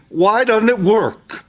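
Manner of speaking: high, aggrieved plea of a believer; frustrated churchgoer venting, high and pleading. Retold or imitated. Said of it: "And they're saying".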